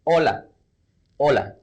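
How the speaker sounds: hola with no H sound; hola said "OH-la", never the H; silent H. Shown as a demonstration. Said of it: "we write it as hola, but instead we pronounce it as".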